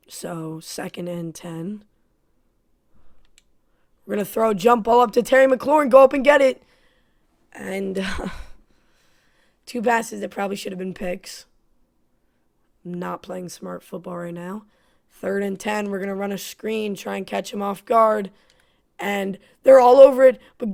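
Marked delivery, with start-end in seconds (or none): 4.1-6.6 fast, louder, rising hype; live hype for a jump ball; excited play call. Real, not acted. Calling a deep pass live. 19.0-20.4 loud, higher burst of alarm; sudden louder alarm at the play; excited alarm. Real, not acted. Defense swarms the screen.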